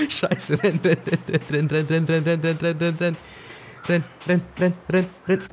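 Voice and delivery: frantic, breathless urging; being chased. Real, not acted. Fleeing wolf again.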